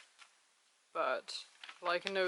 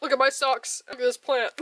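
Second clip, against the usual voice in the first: excited show and tell; 4 semitones higher, 9 dB louder than usual. Retold or imitated. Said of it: "me being like".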